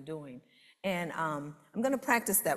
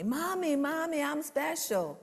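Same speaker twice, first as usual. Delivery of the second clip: put-on much higher, childlike voice calling Mommy; excited little girl; 10 semitones higher, 3 dB louder than usual. Seven-year-old running to her mommy, high and excited. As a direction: play yourself at seven, thrilled.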